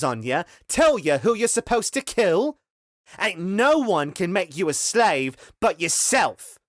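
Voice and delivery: high, loud scornful rant; angry tirade. Acted. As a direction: shame them with a furious rant. Henry's angry tirade at slaves.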